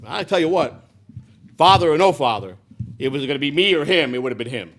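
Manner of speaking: loud, defiant declaration; real fierce vow. Real, not acted. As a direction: swear it with full force. Vows he'd have killed father.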